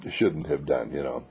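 usual speaking voice

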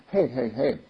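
ordinary talk, nothing marked

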